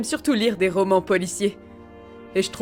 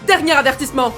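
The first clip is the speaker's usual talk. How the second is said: loud, high final warning; forceful police shout; 5 semitones higher, 7 dB louder than usual. Acted. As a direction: shout a last warning at a suspect. Police officer's final warning to criminals.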